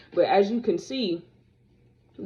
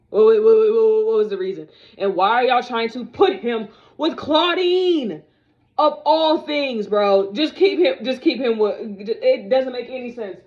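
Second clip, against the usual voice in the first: wait, wait, what? as if you can't believe it; exasperated outburst; 3 semitones higher, 6 dB louder than usual. Real, not acted. Vlogger ranting to fans about Deuce's pairing, loud and exasperated.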